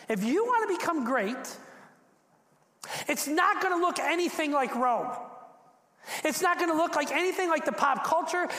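high, driving sermon delivery; impassioned preaching. Real, not acted. Preacher telling followers greatness looks different, impassioned preaching. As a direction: build and press each repeated phrase home.

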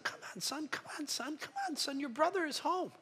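father pleading with his angry son, soft and begging. Acted. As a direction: coax him gently, almost desperate. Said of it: "he's begging his son".

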